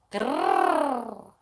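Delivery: long trilled "grrr"; drawn-out playful trill; rolled r growl. Shown as a demonstration. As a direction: exaggerate the Scottish r into a growl. Exaggerates the rolled r.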